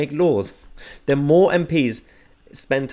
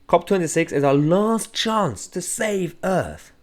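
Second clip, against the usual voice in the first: slow, higher mock-earnest politician voice; 7 semitones higher, slower than usual. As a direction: send up her solemn tweet, slowly. Mock-reading politician's tweet.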